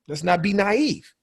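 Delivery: normal talking voice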